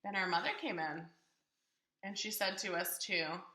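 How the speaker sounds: ordinary delivery, nothing marked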